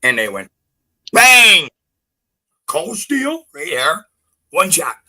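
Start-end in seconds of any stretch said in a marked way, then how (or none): 1.1-1.7 shouted bang like a hammer blow; loud mock impact noise; loud imitated bang. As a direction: slam the word out as the strike. Imitating the chisel strike.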